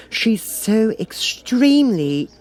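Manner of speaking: put-on high, slow girlish voice; a young girl about to criticise; Alice voice. Alice begins criticising the Queen, high and slow.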